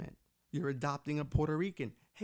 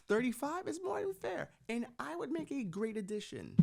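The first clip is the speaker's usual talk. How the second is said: mock pleading; 7 semitones higher than usual. Pitching himself to royals.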